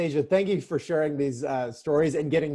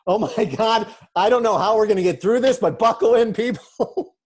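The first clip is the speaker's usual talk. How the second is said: higher, louder, fast panicky announcement; panicked captain; 6 semitones higher, 8 dB louder than usual. Pretend. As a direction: blurt it out like a pilot losing it.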